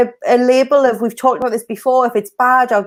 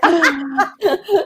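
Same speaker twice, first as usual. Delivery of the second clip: high, bubbly burst of laughter; amused high laughter; real laugh; 5 semitones higher than usual. Real, not acted. Laughing at an unreadable comment.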